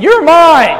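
loud, high mock claim of ownership; possessive claim. Preacher mimics a man just saying it, loudly possessive. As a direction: belt it out like a man claiming his woman. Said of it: "He's not just saying".

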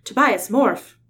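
loud urgent command; urgent shout. Acted. Leader shouts at his injured friend to morph, loud and urgent. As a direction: yell it to wake him.

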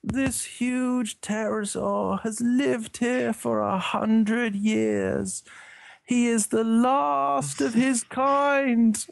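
high, slow, drawn-out nature documentary narration; Kenneth Branagh impression. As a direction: narrate a grand creature introduction, slowly and high. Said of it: "put on my best Kenneth Branagh voice".

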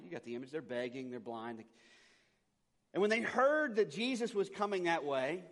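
ordinary talk

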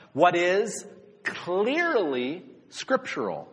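slow, with "clearly" leaned on hard; "what is clearly scriptural", slow and emphatic; stress on clearly. Shown as a demonstration. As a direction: put "clearly" in italics with your voice. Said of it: "I put this in, in, uh, italics".

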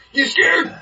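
loud, high challenge; taunting the calm stranger, loud and high; mock menacing taunt. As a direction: bark it up at him. Menacing guy confronts speaker.

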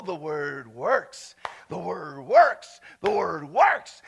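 low, slow "word works" with WORKS swooping up; stress on WORKS. Real, not acted. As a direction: draw out "word" and lift WORKS each time. Chants key point in sermon.

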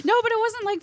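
high-pitched protest through laughter; real laugh spilling into the words. Real, not acted. Laughing protest after listeners' teasing.

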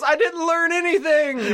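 high, drawn-out mock cry; imitating a slow, high wail. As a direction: stretch it out high, hammed up. Acting out a character's parting cry.

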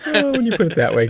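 high, breaking voice through tears of laughter; can barely get words out for laughing; laughing hard. Real, not acted. Reacting to his friend's customs card joke, helpless laughter.

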